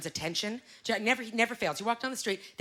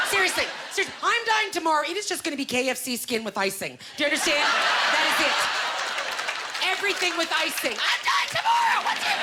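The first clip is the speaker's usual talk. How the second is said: mock frantic rant; 7 semitones higher than usual. To the audience about dying tomorrow, a hammed-up yell. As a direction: whip yourself into a hammed-up frenzy. Ranting about living like it's her last day.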